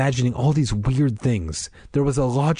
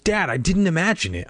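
voice cracking; 8 semitones higher than usual. A narrator's reading. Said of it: "my voice cracking".

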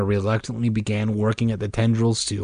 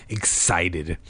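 usual speaking voice

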